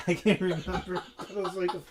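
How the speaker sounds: laughing through the words. Real, not acted. Laughing while recalling.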